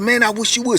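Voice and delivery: daring him to throw, hammed-up; retold threat. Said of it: "He said".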